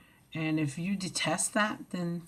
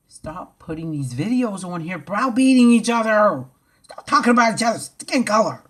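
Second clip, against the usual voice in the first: loud furious rant, higher and louder; angry shouting; 6 semitones higher, 8 dB louder than usual. Real, not acted. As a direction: yell it at the commenters.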